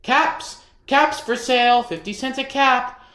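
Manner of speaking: high, loud peddler's cry; calling out wares. A narrator's reading. Peddler calling to passersby, loud and high. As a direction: sing it out to the street. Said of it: "walked down the street calling".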